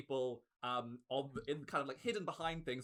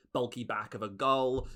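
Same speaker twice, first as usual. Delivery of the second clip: usual speaking voice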